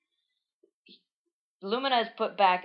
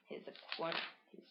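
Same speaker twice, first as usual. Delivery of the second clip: usual speaking voice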